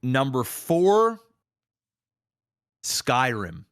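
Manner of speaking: ordinary talk, nothing marked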